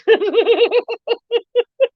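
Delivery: long high giggling fit; real helpless laughter; laughing. Real, not acted. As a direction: giggle away in a high pitch. Laughing after cursing the neighbours.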